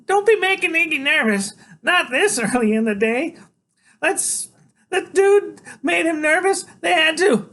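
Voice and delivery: very high, loud woman's squawk; scolding Loretta. A narrator's reading. Loretta warns boy not to make Iggy nervous, high scolding. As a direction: nag in a high-pitched squawk.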